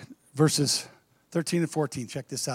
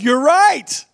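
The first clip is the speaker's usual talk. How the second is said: excited; 8 semitones higher, 5 dB louder than usual. Acted. Jesus confronts woman, laughing.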